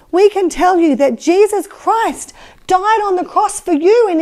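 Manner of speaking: slow, firm preaching voice; bold preaching. Pretend. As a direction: preach it with conviction. Peter preaches boldly.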